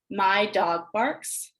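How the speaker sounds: each word set apart, very slow; slow repeat. Shown as a demonstration. Teacher showing the students' slow echo of the sentence. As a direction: say it back slowly so each word stands alone. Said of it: "have the students repeat that sentence back to you".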